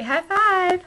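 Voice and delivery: high, loud cheer; loud playful high five; excited high five. Real, not acted. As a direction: shout the high five happily.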